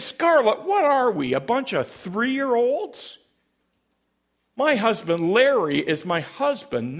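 high, loud indignant protest; acted indignation. Amber indignantly rebukes Scarlett.

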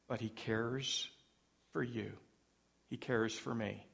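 soft, low and intimate; tender reassurance. Real, not acted. Preacher reassures each listener, soft and intimate. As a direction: say it close and sincere, almost hushed. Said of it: "preacher's tender reassurance".

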